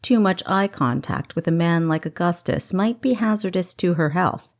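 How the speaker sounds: plain reading voice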